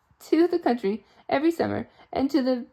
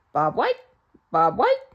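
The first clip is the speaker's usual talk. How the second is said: bird call; 8 dB louder than usual. Acted.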